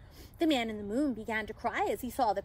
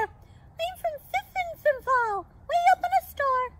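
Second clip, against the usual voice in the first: wee pixie voice; 13 semitones higher, 5 dB louder than usual. A narrator's reading. Pixie telling Santa of his star, wee voice. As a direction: squeak it out proudly like a pixie. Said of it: "In a wee voice answer".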